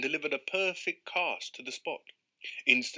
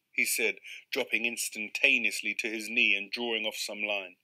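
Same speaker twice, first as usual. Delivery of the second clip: plain reading voice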